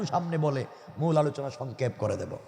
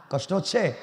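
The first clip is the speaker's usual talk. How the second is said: ordinary talk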